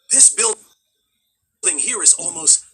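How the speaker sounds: gasp, then slow exaggerated wonder; mock awe. Los Angeles local admiring an old building, mock awe. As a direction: marvel in drawn-out, hammed-up amazement.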